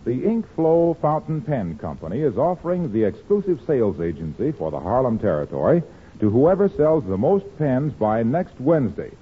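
ordinary delivery

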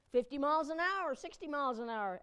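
ordinary talk, nothing marked

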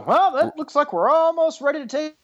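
dentist voice. Retold or imitated. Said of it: "they go".

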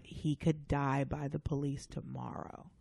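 low, hushed and slow; grave quiet warning. Retold or imitated. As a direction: drop it down to a grim hush. His grave reply.

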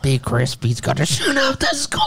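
gruff put-on voice of the anonymous caller; mock threat call. Retelling the hoax caller's threat in a gruff put-on voice.

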